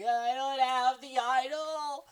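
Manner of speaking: high, drawn-out fake wail; mock crying. Player strips down on the beach, hammed-up wailing. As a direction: whine it out as if sobbing. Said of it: "like, crying".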